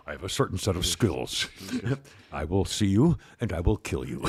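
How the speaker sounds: very low, menacing action-hero growl; Liam Neeson voice. Retold or imitated. Co-host imitating Liam Neeson's threat, very low and menacing. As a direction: go deep and deadly like a movie killer. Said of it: "Liam always talks real, real low like this".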